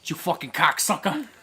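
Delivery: comic mock cursing; crude anime girl. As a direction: ham up the curses as a foul-mouthed cartoon streamer. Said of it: "my fucking voice going like".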